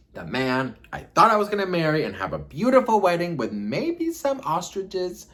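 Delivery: high, loud incredulous rant; theatrical outrage. Real, not acted. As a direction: declaim it in over-the-top disbelief. Outraged recap of the bombshell.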